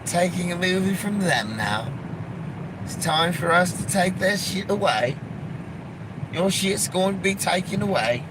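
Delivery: a hammed-up polite English voice; lightly put-on English accent. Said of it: "with an English accent".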